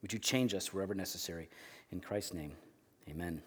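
low, soft closing prayer. Real, not acted. Pastor closing a prayer before the sermon, hushed prayer.

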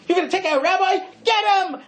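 rally the town to grab him; angry mob shout. Retold or imitated. Angry town spots the wagon.

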